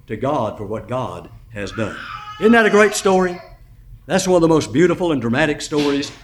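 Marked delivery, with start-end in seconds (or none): 2.4-5.6 rousing sermon close. Acted.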